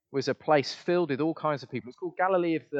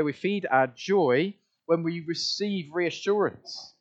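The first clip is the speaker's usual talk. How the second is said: ordinary talk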